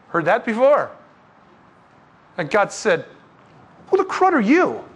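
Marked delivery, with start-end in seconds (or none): 3.9-4.8 a high, sharp mock rebuke; God's rebuke. God rebuking the presumptuous young man, high mock outrage. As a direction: play God cutting the upstart off.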